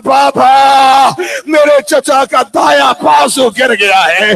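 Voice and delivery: declaimed, anguished cry to a father; retold weeping cry. Sakina tells father of the fallen arm, anguished cry. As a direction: cry the news out in lament. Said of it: "Sakina رو کے کہتی ہے".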